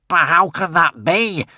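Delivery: swore. Acted. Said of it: "swore Alvin the Treacherous".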